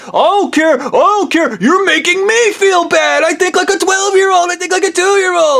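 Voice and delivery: put-on high childish whine; whiny childish mockery. Mocking Obi-Wan dodging blame, high whine. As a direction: sulk like a bratty kid. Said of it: "walking out the back door going".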